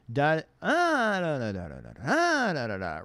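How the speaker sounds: jarring high starts. Shown as a demonstration. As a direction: leap high on each "ah", then fall away on "da". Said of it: "versus if I go low and then high".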